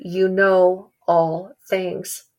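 very slow, drawn-out confession; slow, halting admission of faith; slow and earnest. Retold or imitated. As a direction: let each word of You know all things weigh, slowly. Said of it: "I think him saying".

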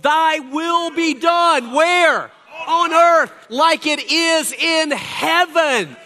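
high, loud, drawn-out preacher's cry; preaching at full force. Acted. Pastor rousing the congregation, preaching at full force. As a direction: proclaim it to the whole church.

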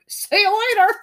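high, loud cheeky goodbye; mock getaway farewell. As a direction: call it out like a gleeful thief fleeing. Voices thief making his getaway.

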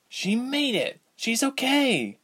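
high, slow relieved cry; relieved girl. A narrator's reading. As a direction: let relief lift it high. Ilse's joyful thought.

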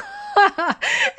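high, loud burst of real laughter; sudden high laugh of amusement. Real, not acted. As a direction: crack up laughing at the absurdity. Laughing at buff actor.

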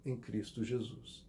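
drops to a near-hush on the name; hushed ending. Real, not acted. Pastor closes the promise to the congregation in a hush. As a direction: let "em Cristo Jesus" fall soft and low.

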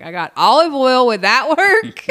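high, loud question breaking into laughter; laughing desperation. Retold or imitated. Botched-wax client seeking a fix, laughing desperation. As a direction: ask it loudly through a laugh.

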